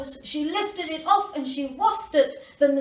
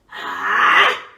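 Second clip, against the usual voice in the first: 1 crocodile snap; 9 dB louder than usual. A narrator's reading. Crocodile snaps its jaws.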